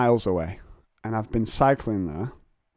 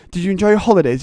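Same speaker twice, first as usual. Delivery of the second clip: "holidays" said in full, high and loud; mock full word; 9 semitones higher, 9 dB louder, much faster than usual. Teacher saying the full word before abbreviating, loud mock. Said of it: "Why say the full word".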